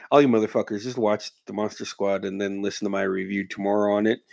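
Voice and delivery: ordinary talk, nothing marked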